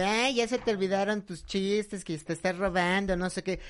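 mock reproach, slowed; imitated complaining fans. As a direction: parody a nagging online critic. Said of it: "me están ahí reclamando de".

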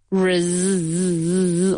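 "Res-" with the s held as a long buzzing z; buzzed S. Shown as a demonstration. Teacher isolating the vibrating s, "Res-" with a long buzz. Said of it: "una S vibrada".

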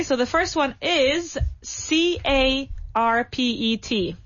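slow letter by letter spelling. Shown as a demonstration. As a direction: spell each letter out slowly. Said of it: "I'm going to spell a couple of words for you".

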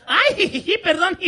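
high, loud "ay, jijiji" with a mock apology; a fake-innocent giggle as she says sorry; giggly fake sorry. (disculpa falsa entre risitas)

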